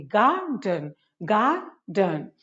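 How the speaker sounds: "गार्डन" broken into gar, pause, den; split syllables. Shown as a demonstration. Teacher drilling two-syllable words, "गार्डन" split as gar - den. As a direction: say each "गार्डन" in two separate beats. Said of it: "दो syllable से बने हैं".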